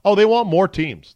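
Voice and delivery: high, loud mock eager whine; mocking coaches. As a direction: parody the greedy coaches. Mimicking greedy coaches wanting more.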